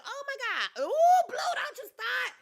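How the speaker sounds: high squealing laugh-scream; startled shriek. Real, not acted.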